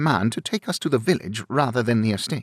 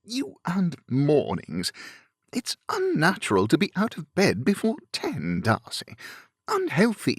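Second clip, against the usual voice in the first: higher-pitched protest from an affable gentleman; groaning complaint; 6 semitones higher than usual. A narrator's reading. Bingley protests Darcy's early morning plans, groaning complaint. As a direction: groan about it in a lifted, lively voice. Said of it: "Bingley groaned".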